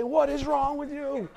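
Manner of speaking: laughing, exasperated mock rebuke; mock scolding. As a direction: scold the sheep, half joking. Shepherd scolds straying sheep.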